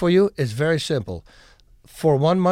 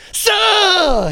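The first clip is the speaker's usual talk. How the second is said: long, loud, high karate cry; mock strike shout; 7 dB louder than usual. Fighter imitating a guy's street karate move, mock strike shout. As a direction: imitate a showy strike with a drawn-out yell.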